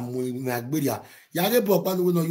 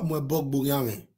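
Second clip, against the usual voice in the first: ordinary talk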